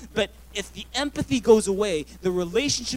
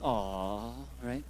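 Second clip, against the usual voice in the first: put-on girls' low aw; 9 semitones lower, 9 dB quieter than usual. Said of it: "Some of the girls are like".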